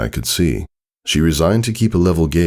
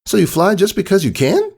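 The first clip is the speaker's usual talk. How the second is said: much higher innocent filly voice; clueless Sweetie Belle. Acted. Sweetie Belle questioning Scootaloo, high and clueless. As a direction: ask it without a clue. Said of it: "Sweetie Belle asked, still clueless".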